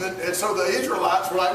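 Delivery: ordinary talk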